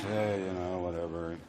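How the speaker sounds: a hint of a too-cool leading man; cool Harrison. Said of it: "And he's".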